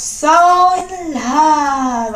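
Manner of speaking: dreamy. Real, not acted. Dreamy remembering.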